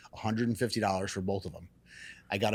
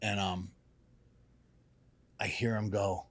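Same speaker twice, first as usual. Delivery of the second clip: usual speaking voice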